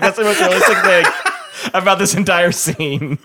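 laughing through the quote. Retold or imitated. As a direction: crack up while quoting. Retells pilot joke, laughing.

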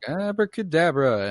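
high, loud magic-word flourish; mock a showman casting his spell; stage magician. Magician closing the cabinet for his trick, showy imitation.

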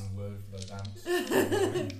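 slow, booming spell incantation; dramatic incantation. Pretend. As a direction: intone it like casting a spell. Dramatic spell casting joke.

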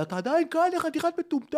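high, loud outraged yell; angry shout. Retold or imitated. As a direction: yell it at the idiot who's still here. Boyfriend shouting at narrator.

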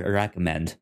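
normal talking voice

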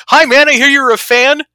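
loud, high celebrity hello; imitated star making a surprise entrance; booming greeting. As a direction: hail a fan with a big acted greeting. Acts celebrity surprising his fan.

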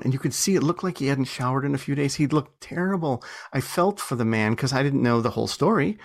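ordinary delivery

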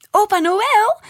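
put-on high, loud cheer of a delighted child. A narrator's reading. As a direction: shout it with joy, rising at the end. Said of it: "juichte Noor". (juichend, hoog en luid)

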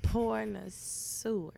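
"pornosaur" drawn out syllable by syllable; very slow, deliberate "pornosaur". Shown as a demonstration. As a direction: stretch "pornosaur" slowly, careful on the "soor". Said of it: "you gotta say it slow".

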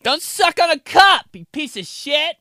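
high loud nagging mom; shrill angry mom voice; mom scolding. Retold or imitated. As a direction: scold like a fed-up mother. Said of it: "my mom".

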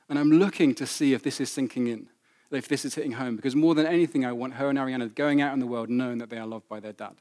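ordinary talk

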